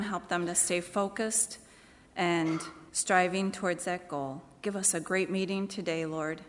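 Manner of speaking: soft, earnest prayer voice. Acted. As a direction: lead the church in a quiet, sincere prayer. Leads congregation in prayer.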